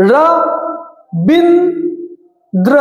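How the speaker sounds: "র", "বিন", "দ্র" as separate beats with long pauses; syllable by syllable. Shown as a demonstration. Teacher counting syllables for students, "র ... বিন ... দ্র" split apart. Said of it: "দেখো কয়বারে আমি pronounce করছি".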